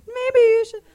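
high and loud mock voice; loud high caricature of the host; imitating Brandon. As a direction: parrot him shrilly. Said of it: "now I'm doing your voice".

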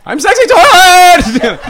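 high, loud, drawn-out protest; hammed-up indignant holler; mock desperate yell. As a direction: wail it at a jeering crowd. Protesting to mocking party crowd.

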